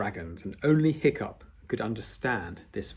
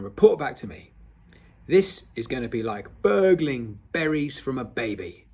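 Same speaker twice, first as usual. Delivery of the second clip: loud, hearty bellow of a Viking teacher; a big loud drill instructor; booming; 3 semitones higher, 6 dB louder than usual. Acted. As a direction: boom it across the boats. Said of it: "boomed Gobber the Belch".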